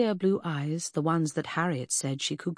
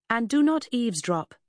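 a stern little warning, a bit louder; 6 semitones higher, 4 dB louder than usual. A narrator's reading. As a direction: wag a finger with your voice. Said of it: "I warned".

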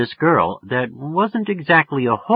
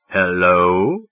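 slow, deepened phone hello; putting on depth; 3 semitones lower, 4 dB louder than usual. A narrator's reading. Teen phoning a stranger, faking a deep voice. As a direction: lengthen it and keep it low, questioning. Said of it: "I made my voice quite deep".

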